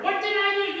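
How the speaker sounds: high, loud hurt question; bewildered. Acted. Congregation as the kicked cat, high bewildered. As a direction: ask like the stunned cat. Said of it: "we sit there and wonder".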